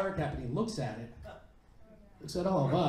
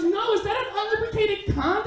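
very high-pitched questioning; put-on high voice for a wary woman; suspicious date; 4 dB louder than usual.

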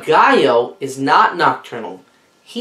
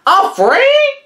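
much higher, louder scoffing parrot; even higher mocking squawk; 5 dB louder than usual. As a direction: push it up into a squawk. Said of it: "clucked Papagayo".

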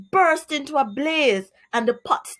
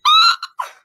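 a sudden shrill wail, much higher and louder; dismal cry; 8 dB louder than usual. Acted. Mister Alligator finds the eggs gone, shrill dismal cry. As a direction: let out a shocked, piercing cry. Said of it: "He uttered a dismal cry".

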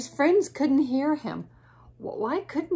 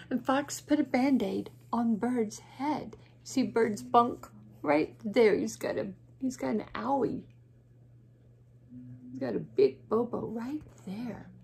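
plain reading voice

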